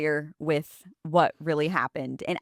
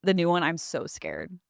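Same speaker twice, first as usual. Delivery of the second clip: ordinary talk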